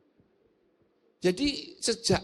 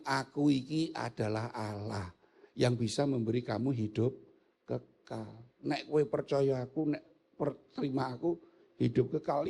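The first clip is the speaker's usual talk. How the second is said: solemn voice of Jesus; 6 semitones lower, 4 dB quieter than usual. Acted. Preacher voicing Jesus telling the crowd he gives eternal life, low and solemn. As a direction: speak it low and grave as Jesus declaring himself.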